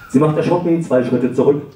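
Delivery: normal talking voice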